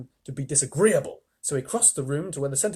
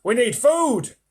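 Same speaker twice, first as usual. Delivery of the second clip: higher, louder centipede; 10 semitones higher, 9 dB louder, slower than usual. Acted. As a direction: announce it boldly. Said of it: "he said".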